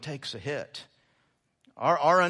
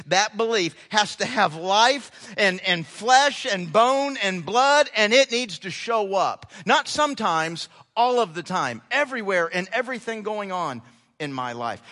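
full-force preaching; 3 semitones higher, 6 dB louder than usual. Acted. Pastor pressing scripture on the congregation, fervent preaching. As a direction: hammer the command home.

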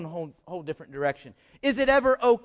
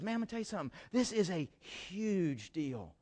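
hushed Jesus; 11 dB quieter than usual. Acted. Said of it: "And Jesus says".

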